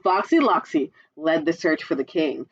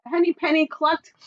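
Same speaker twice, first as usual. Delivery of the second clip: ordinary delivery, nothing marked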